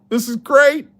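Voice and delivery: high, falsetto squeal of mock joy; squeaky hype. Streamer squealing over a fake coin for chat, mock delight. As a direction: squeal it like a kid at Christmas.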